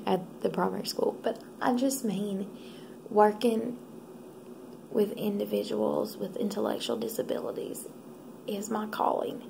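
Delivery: ordinary talk, nothing marked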